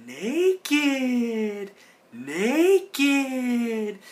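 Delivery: high, excited cartoon voice, lightly hammed; imitating Heffer. Heffer gleefully confirms Rocco is naked, high cartoon voice. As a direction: stretch out naked with giddy glee. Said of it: "Heffer's like".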